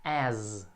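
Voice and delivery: "As" ending in a long buzzing Z. Shown as a demonstration. Teacher models for students, "As" with a drawn-out Z ending. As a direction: hold the voiced Z that closes "As". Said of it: "tem um som de Z no final".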